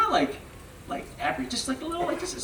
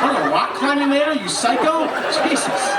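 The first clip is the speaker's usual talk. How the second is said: exasperated yell; 9 semitones higher than usual. Retold or imitated. Scolding dad on cliff hike.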